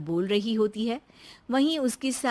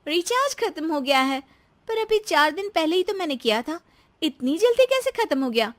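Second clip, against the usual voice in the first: high-pitched wife's voice, baffled; puzzled daughter-in-law; 7 semitones higher, faster than usual. Acted. Daughter-in-law finding the phone recharge used up, puzzled. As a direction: question it in a raised pitch.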